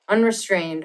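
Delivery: usual speaking voice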